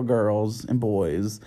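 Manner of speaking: put-on a sing-song host's hello, "girls and boys" drawn out; slow drawn-out greeting. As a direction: stretch "girls and boys" slowly, rising at the end.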